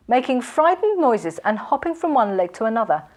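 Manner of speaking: ordinary delivery, nothing marked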